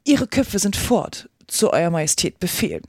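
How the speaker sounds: usual speaking voice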